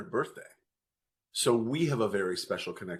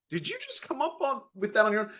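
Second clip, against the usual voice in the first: amazed disbelief; 12 semitones higher, faster than usual. Retold or imitated. Dad amazed at son's security idea, high astonished question. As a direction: voice jumps much higher in astonished disbelief.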